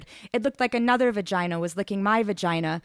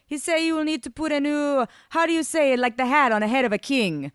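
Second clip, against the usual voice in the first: put-on high, lilting accented English; Italian translator with raised pitch; foreign interpreter; 7 semitones higher, 4 dB louder than usual. A narrator's reading. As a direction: search for the word in broken English. Ivan translates, searching for a word.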